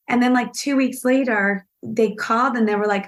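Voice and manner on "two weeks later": usual speaking voice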